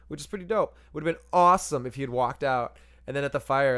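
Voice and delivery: ordinary talk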